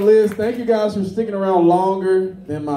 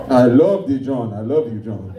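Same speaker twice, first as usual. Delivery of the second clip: put-on African dad accent; 5 semitones lower than usual. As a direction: imitate an African father's tender declaration. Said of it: "I got an African dad too".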